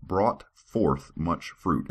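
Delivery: slow, word-by-word tongue twister; slow careful. Shown as a demonstration. Reader stumbling over scripture, slow. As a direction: spell it out slowly. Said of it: "that is hard to say".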